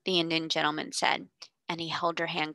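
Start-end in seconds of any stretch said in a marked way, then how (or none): none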